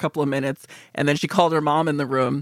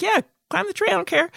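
breezy careless permission; 13 semitones higher than usual. Retold or imitated. Telling kid to go ahead.

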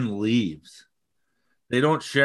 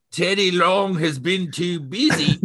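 imitating Drew's thick Scottish accent, pitched higher; mock early-career Scottish brogue, a bit higher; 6 semitones higher than usual. Host mimicking the wrestler's thick Scottish debut voice. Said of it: "how did he get such a thicker voice".

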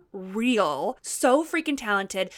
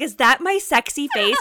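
loud, higher-pitched horrified question; retold horror, raised in disbelief; 4 semitones higher, 6 dB louder than usual. Host retells seeing her own photo, horrified. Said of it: "I was horrified".

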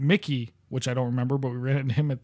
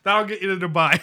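laughing; 4 semitones higher than usual. Real, not acted. Laughing at the Dubai joke.

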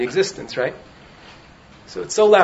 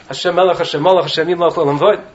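a louder, half-hearted proclamation; raised-voice chant-like declaration; mock shout; 5 dB louder than usual. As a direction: call it out without fully shouting. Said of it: "It means to shout".

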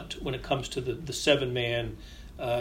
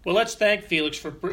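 normal talking voice

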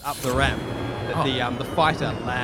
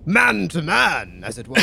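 stiff-upper-lip invitation; royal accent. Acted. Pilot Billy Boy signing off to the enemy officer, lightly posh. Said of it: "quite good with accents".

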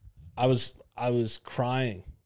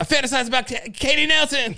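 high, loud mock tic blurt; building high-pitched outburst; cartoon outburst quote; 13 semitones higher, 5 dB louder than usual. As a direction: yell the secret out uncontrollably, comically. Quoting South Park character's blurted secret.

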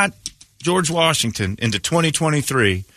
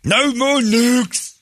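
triumphant cry; 12 semitones higher, much slower than usual. Acted. Leader in a Rocky robe announcing victory, high triumphant cry. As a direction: stretch it out like a champion's cheer.

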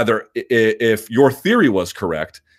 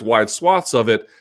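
ordinary delivery

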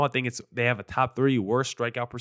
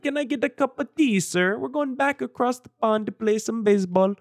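hammed-up British accent, pitched way up; terrible fake British accent, high-voiced; 9 semitones higher than usual. Shown as a demonstration. Host showing listeners his best bad British accent, British accent. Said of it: "That's my best, uh, British accent".

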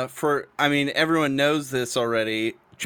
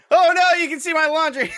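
high, louder embarrassed cry; embarrassed surprise; 13 semitones higher, 4 dB louder than usual. Real, not acted. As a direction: yelp at being caught out. Embarrassed on camera.

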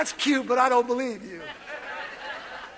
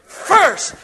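louder first for the congregation; loud repeated call; 3 semitones higher, 5 dB louder than usual. Shown as a demonstration. Pastor drills the congregation, loud. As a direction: drive the word home. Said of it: "Say it again".

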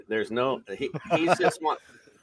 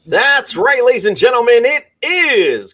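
loud, high show-opening announcer; announcer hype; 7 semitones higher, 11 dB louder than usual. Acted. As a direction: boom it out like a host.

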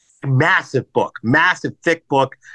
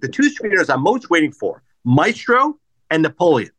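usual speaking voice

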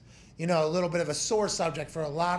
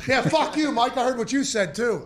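higher, louder laughing snap back; playful fake blow-up at the coach; mock tell-off; 7 semitones higher, 6 dB louder than usual. Punter telling off coach Mike, loud and laughing.

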